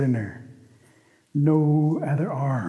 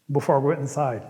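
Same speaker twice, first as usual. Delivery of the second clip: usual speaking voice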